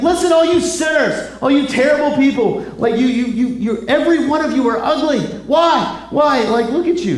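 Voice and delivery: hammed-up insults at a grumbling crowd; ranting Moses.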